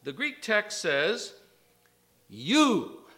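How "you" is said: You punched, louder; stress on You. Shown as a demonstration. Preacher stresses "You," to the frightened women. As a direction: lean hard on "You,". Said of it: "it's emphatic".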